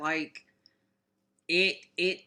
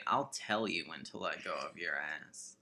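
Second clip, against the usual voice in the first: low, soft creepy sultry voice; 12 semitones lower, 12 dB quieter than usual. Pretend. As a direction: purr it low and soft like a seducer. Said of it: "That was really creepy".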